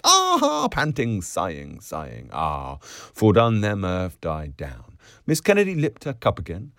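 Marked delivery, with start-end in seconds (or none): 0.0-0.7 loud, very high "ah"; shrill note of laughter; high piercing cry. A narrator's reading. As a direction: let out a piercing squeal. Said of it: "high piercing notes".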